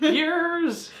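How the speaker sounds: high, loud exclaim of Years; emphatic shout. Shown as a demonstration. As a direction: belt out Years with mock outrage. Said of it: "I say".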